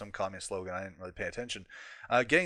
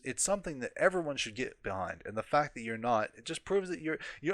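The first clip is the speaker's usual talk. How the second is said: ordinary talk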